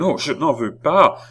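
high, loud cry of refusal. A narrator's reading. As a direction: exclaim it, refusing outright. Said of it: "s'écria la reine". (refus crié, voix haute)

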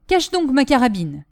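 ordinary delivery, nothing marked

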